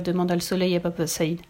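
ordinary delivery, nothing marked

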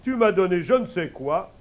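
loud high laughing king; king bursting out laughing. Acted. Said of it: "puis il éclate de rire".

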